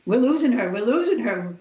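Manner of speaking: urgent nurse. Retold or imitated. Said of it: "one nurse kept saying".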